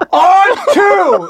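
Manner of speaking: high-pitched yell right into someone's face; imitated in-your-face huddle yell. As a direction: bellow the play call at a slow teammate. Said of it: "yells it in his face".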